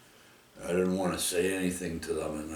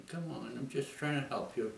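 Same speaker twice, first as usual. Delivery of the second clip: higher and softer, gently coaxing; calm voice; 7 semitones higher, 5 dB quieter than usual. Retold or imitated. Soothing the stunned falcon gripping his arm, higher, soft and calm. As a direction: coo it like calming a scared animal. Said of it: "in a calm voice and that".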